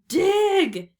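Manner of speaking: high, loud barked command; shouting. A narrator's reading. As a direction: shout it as the pirate captain's order. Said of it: "shouted Cap'n Bones".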